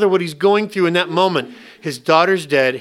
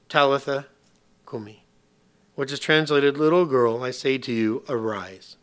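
"Talitha cumi" drawn out slowly and softly, words fading lower; slow solemn delivery; 4 semitones lower, 5 dB quieter than usual. Shown as a demonstration. Jesus speaking to the dead girl, slow and hushed. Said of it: "This is like a really bad kung fu movie".

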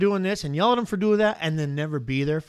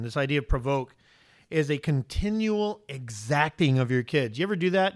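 ordinary talk, nothing marked

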